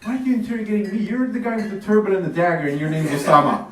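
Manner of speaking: interrogated traveller protests to Omani guards, rising indignation; indignant rant. Retold or imitated. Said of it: "and it's like".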